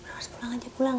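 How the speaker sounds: hushed, urgent whisper; whispered plea. Retold or imitated. Wife quietly urging the technician to leave, whispered. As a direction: whisper so the husband won't hear.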